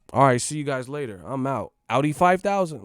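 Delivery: loud, corny mock farewell; try-hard slang sign-off. Mocking someone leaving with old slang, loud corny imitation. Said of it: "some people just don't sound right".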